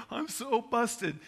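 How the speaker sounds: put-on high, drawn-out whine of a caught child; mock crying. Dad imitating his son caught calling emergency services, mock crying. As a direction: imitate a tearful kid, slow and high. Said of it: "He's just crying, like".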